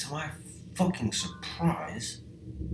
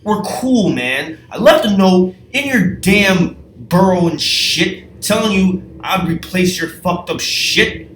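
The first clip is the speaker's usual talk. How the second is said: loud and high, exasperated ranting; shouting, angry and disbelieving at George; furious yelling; 7 semitones higher, 11 dB louder than usual. Retold or imitated. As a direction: rant at him for the dirt pile. Yelling at George over dirt.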